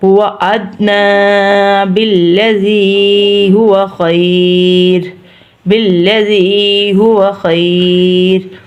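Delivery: very slow, "ذی" held long in "بالذی"; elongated recitation. Shown as a demonstration. Teacher demonstrating long letters, elongated recitation. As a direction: sustain vowels level, drawing out "خیر". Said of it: "تینوں حروف لمبے ہوتے ہیں".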